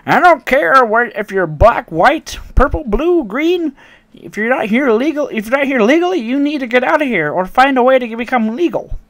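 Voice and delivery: high, loud mock old lady rant; hammed-up furious granny yelling about jobs. Racist customer voiced in story.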